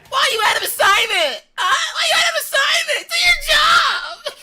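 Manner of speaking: very high, loud, screechy whine; whiny coach impression. Streamer imitates his old football coach, whiny shrieking. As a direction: yell like a clingy girlfriend crossed with the Joker. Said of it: "a really whiny, like, c-, clingy girlfriend".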